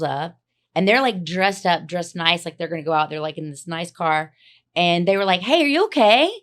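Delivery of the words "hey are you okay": high, loud rising questions; imitated concerned calling. Voicing girls in a car checking on her, imitated high urgent calling. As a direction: call out an urgent offer, pitched up.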